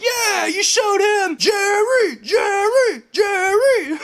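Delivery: very high, drawn-out chanting shout; mock crowd cheer. Imitating the clapping jury cheering him on, mock cheer. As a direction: squeal it like a talk-show audience chant. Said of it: "sitting there clapping like".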